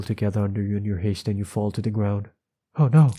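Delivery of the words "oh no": high, loud cry of dismay; alarmed exclamation. Real, not acted. Reader reacting to the fall in the story, alarmed exclamation. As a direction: blurt it out in alarm.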